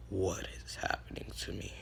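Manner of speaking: put-on deepened voice, hushed and breath-heavy; low murmur of someone just awakened. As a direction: drop into a low, dark hero voice. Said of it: "his voice got deeper".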